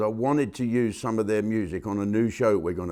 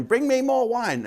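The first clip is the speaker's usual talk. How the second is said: squeaky mock Japanese accent, pitched way up; parroting a Japanese tourist's order in a high mock Japanese accent; 13 semitones higher than usual. Flight attendant mocking a Japanese tourist ordering wine, in a high mock Japanese accent. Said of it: "making fun of a Japanese tourist saying".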